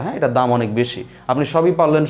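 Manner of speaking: ordinary delivery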